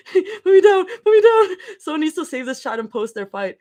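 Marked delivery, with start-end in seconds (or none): 0.1-1.6 squealed protest. Acted. Playful outburst reading the chat.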